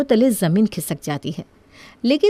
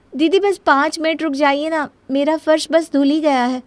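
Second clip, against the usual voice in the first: higher-pitched, polite pleading; 5 semitones higher than usual. Acted. Younger sister-in-law asking elder to wait, pleading. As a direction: coax for a little more time.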